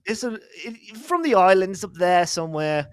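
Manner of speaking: mock island accent, vaguely Irish; playful put-on accent from somewhere in the British Isles; attempted European accent. Shown as a demonstration. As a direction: keep up a badly faked European accent. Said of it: "It's kinda Irish".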